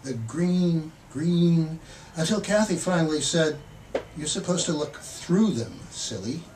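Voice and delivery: measured, expressive poetry reading; poem reading. Acted. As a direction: read the poem like you're savouring each image. Poet reads his poem aloud.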